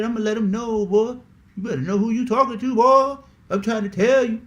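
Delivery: put-on bragging voice, held up high; mock tough guy. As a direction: imitate someone showing off as a gangster. Mimics boastful armed youngsters.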